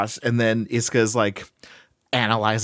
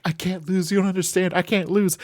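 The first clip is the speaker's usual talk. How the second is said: raised pitch, insisting she can't lose; frantic Iska; 8 semitones higher than usual. Retold or imitated. Iska insists to Storm, mildly frantic and raised. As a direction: plead it higher, desperate and disbelieving. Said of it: "she is just like".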